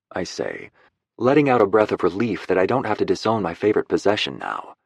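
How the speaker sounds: ordinary delivery, nothing marked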